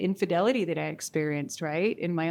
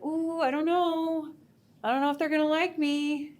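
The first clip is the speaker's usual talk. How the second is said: put-on timid little girl; 7 semitones higher than usual. Speaker voicing her younger self before a talk, lightly timid. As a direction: lightly voice a shy little girl doubting herself. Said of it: "Little [name] still showed up and was like".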